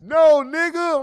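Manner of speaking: high, loud indignant no. Real, not acted. Streamer shutting down Phoenix's comment, loud. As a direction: cut him off, loud.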